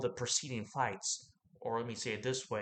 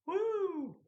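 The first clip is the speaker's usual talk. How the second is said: excited woo. Real, not acted. Laughing at the dog price, a high excited whoop. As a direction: let out a sudden high whoop.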